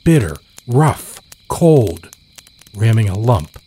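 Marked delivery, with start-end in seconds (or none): none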